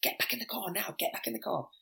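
high, hushed urgent scolding; wife scolding. Retold or imitated. Wife pulls her man back into the car. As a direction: hiss at him to get back in. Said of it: "the girlfriend or the wife and that just went".

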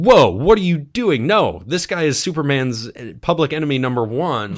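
hammed-up shock, warning a friend; Adam warning Seg about Zod, mock alarmed. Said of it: "And Adam saying".

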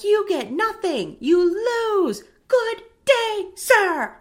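loud, high furious yell; screaming Wonka. Retold or imitated. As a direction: blow up at the boy in a rage. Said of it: "Wonka's, like, screaming".

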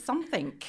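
"something" ending in a hard K. Retold or imitated. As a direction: say "something" with a K tacked on. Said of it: "he puts a K at the end".

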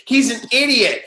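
high, scornful sister voice; mocking older sister. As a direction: sneer at her dumb brother. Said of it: "Sarah's like".

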